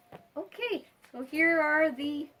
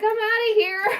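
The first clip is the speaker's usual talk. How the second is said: high, louder squeaky pet voice; mock fleeing bird; 10 semitones higher, 6 dB louder, faster than usual. Owner voicing Whitey backing off, mock panic. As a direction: play the bird bailing out in a squeak. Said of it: "She's like".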